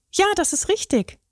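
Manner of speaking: a little straw answering brightly; small straw boy. A narrator's reading.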